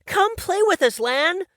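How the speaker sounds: put-on very high, loud kid voice; excited little boy. Little brother begging his brother to come play. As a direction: beg like an eager child. Child Jack's voice.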